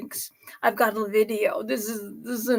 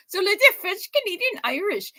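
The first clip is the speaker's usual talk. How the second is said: Irish brogue; 9 semitones higher than usual. Pretend. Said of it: "back to full Irish brogue".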